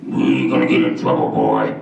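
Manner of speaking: forced bass voice; deep chamber voice. Shown as a demonstration. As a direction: drop it way down into a chest chamber. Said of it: "I don't really have that pitch".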